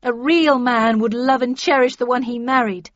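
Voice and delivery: loud, indignant rebuttal; angry shout. A narrator's reading. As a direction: shout down a rival wife. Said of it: "she shouted".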